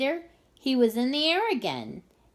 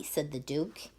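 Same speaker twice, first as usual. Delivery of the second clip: plain reading voice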